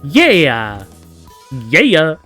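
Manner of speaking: loud, drawn-out "yeah"; eager cheer. Acted. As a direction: cheer it out loudly. Voices child's eager reply.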